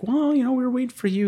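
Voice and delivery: high, prissy mock nurse voice; defensive nurse. Nurse excusing herself to the doctor, high mock voice. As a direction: pitch it way up like the nurse.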